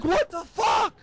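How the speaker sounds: high, squeaky mock shock; shocked disbelief. Son reacts to dad shooting himself, high shocked squeal. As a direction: pitch it way up, rising in disbelief.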